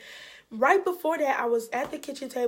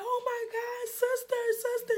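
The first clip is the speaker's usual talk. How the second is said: very high, overwhelmed cry of recognition; imitated tearful joy; 11 semitones higher than usual. Long-lost sister hearing Jacqueline on the phone, tearful joy. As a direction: imitate a sister breaking into happy tears. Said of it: "she just started crying".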